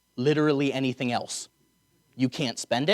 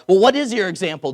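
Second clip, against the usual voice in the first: loud, raised-pitch challenge from the crowd; yelling out from a crowd; 7 semitones higher, 10 dB louder than usual. Acted. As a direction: shout it as the one in every crowd. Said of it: "someone else yells out".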